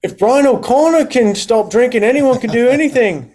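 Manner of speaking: imitating an Irish client, pitched well up; light Irish lilt, quoting a friend; playful Irish accent impression. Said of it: "I'll do the accent".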